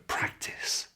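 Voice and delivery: whispered aside to learners; hushed reminder under the lesson; whispered cue. Shown as a demonstration. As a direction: whisper the instruction softly.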